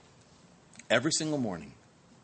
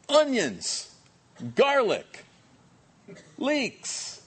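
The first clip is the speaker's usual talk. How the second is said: incredulous; 8 semitones higher, much slower than usual. Acted. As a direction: spit each food out in disbelief. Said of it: "listing Egypt foods".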